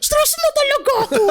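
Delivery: high, squealed mock stress; high exaggerated conyo complaint; mock conyo whine. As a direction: overact being stressed. Mocking conyo overreaction.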